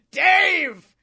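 loud, high haughty cat shout; cat yell. Retold or imitated. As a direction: demand petting like a snooty cat. Cat yelling.

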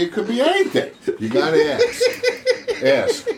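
squeaky laughing voice. Acted. Teasing squeal mid-laugh.

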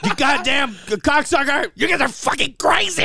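high, loud mock tirade broken by laughs; hammed-up screaming rant; mock rage. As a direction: scream it like an unhinged host. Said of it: "Alex Jones".

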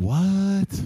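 loud, high, drawn-out astonishment; stunned. Retold or imitated. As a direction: let out a high, baffled exclamation. Stunned reaction, fresh from college.

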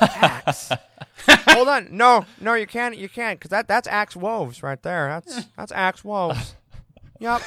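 halting, repetitive film-director impression; George Lucas. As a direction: draw out the words in a hesitant director's impression. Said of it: "such a good George Lucas impression".

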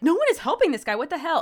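high, loud disbelief; exasperated outrage. Retold or imitated. Reader's reaction to nobody helping the man, exasperated outrage. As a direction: protest loudly, half in jest.

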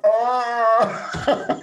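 long, high, loud mock howl; retold pain howl. Runner retelling breaking his toe, mock howl of pain. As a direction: howl as if hurt, then laugh.

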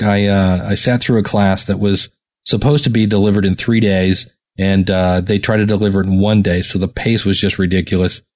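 low, even everyday talk with little inflection; normal off-mic talk. Shown as a demonstration. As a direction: talk casually and monotone, as in ordinary small talk. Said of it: "my normal talk when I talk like this".

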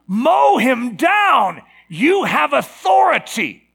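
slow, high, loud battle cry; rousing shout. Acted. Preacher urging believers to crush the enemy, rousing shout. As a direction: shout it as a command to charge.

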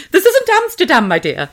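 high, prim put-on lady voice; a hammed-up scolding grande dame; mock prim lady. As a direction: play a prissy lady shooing visitors off. Joke lady scolding visitors.

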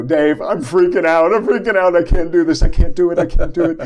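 loud, high frantic panic; panicked freakout. Retold or imitated. Retelling a call begging coworker Dave for help, frantic panic. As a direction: blurt it out in a loud, high-pitched panic, repeating yourself.

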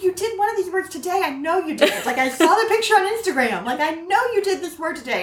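high-pitched, exasperated insisting; retold mock-exasperated protest; insistent parent. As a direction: argue with a forgetful child, half laughing. Parent insisting to forgetful child.

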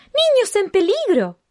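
high loud urgent announcement; dramatic alarm call. Acted. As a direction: cry out the danger. Anuncio dramático de peligro. (anuncio de alarma fuerte y agudo)

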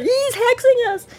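high, slow, wailing cry; dramatic wail. Acted. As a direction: wail it like a melodrama. Dramatic quote repeat.

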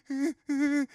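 high, loud fake sobbing; mock crying. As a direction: whimper it like a blubbering teenager. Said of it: "like, crying".

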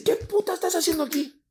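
very high mock-scolding voice; indignant sister. Sister to brother arriving at night, indignant. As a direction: imitate an outraged sister demanding answers.

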